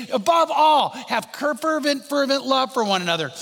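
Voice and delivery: above all, high and loud; preaching shout. Acted. Preacher to congregation, loud rising call.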